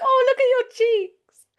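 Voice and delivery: high, loud gushing voice; gushing admirer. Retold or imitated. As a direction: coo over cute cheeks. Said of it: "People are always like".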